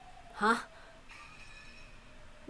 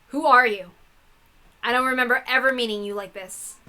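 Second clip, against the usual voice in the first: defiant; 2 semitones higher, 6 dB louder than usual. Acted. Angie confronts the false Hachijo.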